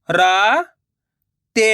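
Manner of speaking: राते drawn out slowly, syllable by syllable; slow syllable split. Shown as a demonstration. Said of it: "मैं किस तरीके से".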